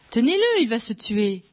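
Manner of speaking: high, alarmed plea; frightened wail. A narrator's reading. Éponine begging the abbé to hold him, afraid. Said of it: "Éponine, effrayée, gémit".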